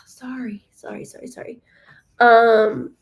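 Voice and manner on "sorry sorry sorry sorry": breathy unvoiced apologies, trailing off; whispered sorry. Real, not acted. Reader apologizing to chat, whispered. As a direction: whisper a string of quick sorries. Apologizing to viewers mid-stream.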